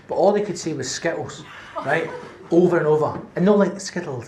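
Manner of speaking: ordinary talk, nothing marked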